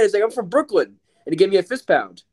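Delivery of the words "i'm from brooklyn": much higher, excited cop voice; squeaky high impression of a friendly officer; imitating the officer. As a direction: play up the Brooklyn cop's delight.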